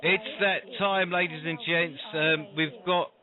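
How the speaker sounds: ladies-and-gents stage introduction with dramatic pauses; announcer introduction. Acted. Introduces the guest like a showman.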